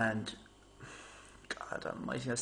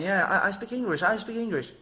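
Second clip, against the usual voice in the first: higher, louder mock waiter insisting; imitating waiters; 6 semitones higher, 5 dB louder than usual. Waiters insisting to the customers, louder mock imitation. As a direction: parrot it back eagerly in a higher voice. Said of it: "they were all saying".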